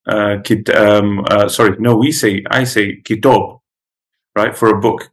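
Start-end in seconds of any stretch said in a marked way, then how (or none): 3.0-3.4 "kitab" with a rounded "aw", like "kitawb"; full-mouth pronunciation. Shown as a demonstration. Giving his own dialect's word, full-mouth "kitab". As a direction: round out the last vowel of "kitab". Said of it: "ours is more of a full mouth".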